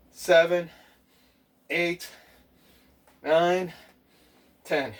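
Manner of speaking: lifted, evenly spaced workout count; counting out reps. Real, not acted. Coach leading viewers through squats, counting aloud. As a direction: call out each rep on the beat.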